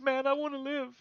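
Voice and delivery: high, frightened begging; desperate plea. Acted. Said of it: "there's a very good chance that you live".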